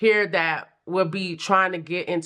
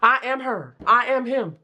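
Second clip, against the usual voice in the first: sassy affirmation; 5 semitones higher than usual. Retold or imitated. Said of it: "Do somebody talk like that?".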